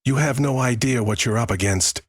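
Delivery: high-pitched, tense warning; hissed threat. A narrator's reading. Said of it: "Walter hissed".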